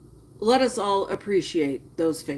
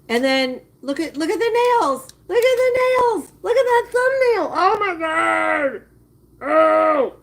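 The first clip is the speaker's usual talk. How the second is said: much higher and louder, squealing at the nails; shrieking disgust; 7 semitones higher, 4 dB louder than usual. Real, not acted. Pointing out dirty nails to the chat, disgusted squeal. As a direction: shriek it out, grossed out.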